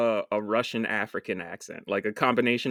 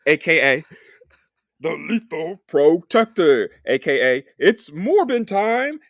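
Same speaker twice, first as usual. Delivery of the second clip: hype announcer voice; 3 semitones higher, 6 dB louder than usual. Pretend.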